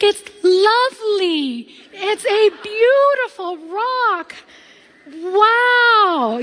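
high, slow, overdone courteous gushing; polite fake praise. Speaker to Granny Silver, gushing politely over a rock. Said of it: "we've all been taught to be courteous".

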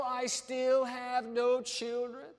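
slow, high line leaning on "still"; stress on STILL. Acted. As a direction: draw out "still" in a high-pitched complaint. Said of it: "the emphasis on the word still".

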